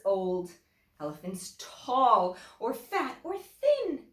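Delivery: lively storybook reading with big swings; animated story voice for young listeners; reading to children. A narrator's reading. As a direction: read it to the class like a picture book. Teacher reads picture book aloud.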